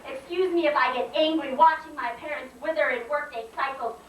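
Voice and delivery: high-pitched, driving poetry delivery; indignant slam poem. Acted. Poet protests her mispronounced name, indignant spoken-word declaiming. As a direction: declaim the grievance phrase by phrase.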